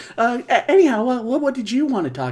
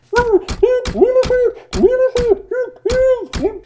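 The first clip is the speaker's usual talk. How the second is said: high, long ghoulish cackle; evil cackle; 10 dB louder than usual. Acted. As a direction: cackle with wicked glee. Crypt Keeper cackles.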